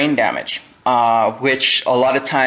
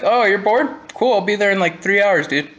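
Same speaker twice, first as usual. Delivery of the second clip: higher-pitched, breezy offer to a friend; casual, keen buddy voice, pitched up; eager upbeat quote; 8 semitones higher than usual. Retold or imitated. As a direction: act out your old eager self. Acting out his old eager self.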